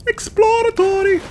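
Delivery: "Exploratory!" squeaked very high and slow; high squeaky exploratory. Shown as a demonstration. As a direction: shriek "Exploratory!" in a tiny high voice.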